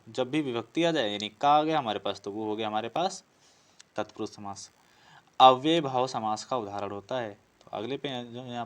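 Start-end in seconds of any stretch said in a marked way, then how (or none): none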